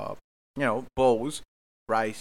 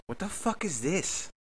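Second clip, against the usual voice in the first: put-on higher, slow outraged girlfriend voice; mock girlfriend, higher and slow; mimicking girlfriend; 7 semitones higher, 3 dB quieter, slower than usual. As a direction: play her disgusted at the food. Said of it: "she opens it up and she goes".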